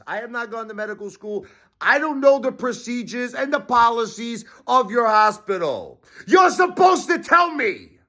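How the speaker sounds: high, strained exasperated yell; high-pitched angry rant. Real, not acted. Berating the hospital staff, high strained rant. Ranting at doctors.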